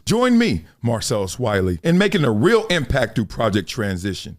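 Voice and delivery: steady sponsor-spot delivery; ad read. Acted. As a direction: read it like a polished ad. Reads a sponsor ad.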